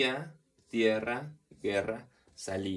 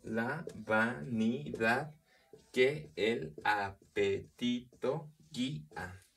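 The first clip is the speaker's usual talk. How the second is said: very slow, every syllable set apart; syllable-by-syllable scansion; much slower than usual. Shown as a demonstration. Teacher counting the syllables of a verse, slow scansion. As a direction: break the verse into separate, measured syllables.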